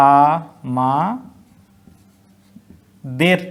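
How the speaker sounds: আ, মা, দের split into single syllables with long pauses; slow syllable count. Shown as a demonstration. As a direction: break আমাদের into its syllables, stopping on each. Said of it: "এই আমরা এখানে থেমে যাচ্ছি".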